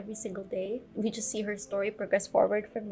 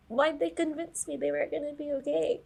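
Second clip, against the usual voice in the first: normal talking voice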